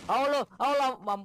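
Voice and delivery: loud, high yelp; alarmed outcry. Real, not acted. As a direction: cry out as you get hit. Cries out on getting shot.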